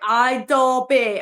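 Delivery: slow, drawn-out mock Scottish accent; silly Scottish accent. Answering her pad mate's nickname in a silly Scottish accent. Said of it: "we used to do this Scottish accent".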